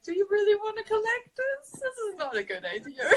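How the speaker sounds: high-pitched, embarrassed plea; mock pleading. Embarrassed student talking teacher out of collecting paper, mock pleading.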